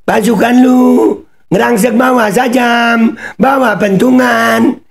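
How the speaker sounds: high-pitched, drawn-out angry yell; angry shouting. Real, not acted. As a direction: yell it high and loud, stretching the phrases. Mocks played peace song, accusing attackers.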